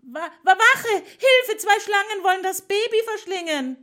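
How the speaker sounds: high, loud stammering scream; panicked screaming. A narrator's reading. Nurse screaming for guards to save the baby.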